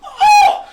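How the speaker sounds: very high, loud shocked "oh"; horrified cry. Acted. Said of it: "you just hear".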